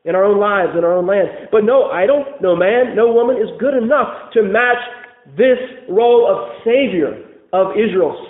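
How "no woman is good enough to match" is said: usual speaking voice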